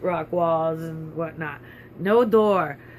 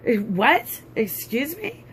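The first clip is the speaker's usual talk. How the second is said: rising, higher-pitched mock disbelief; incredulous; 6 semitones higher, slower than usual. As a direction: query it as if you can't believe it. Acting out disbelief at friend's knowledge.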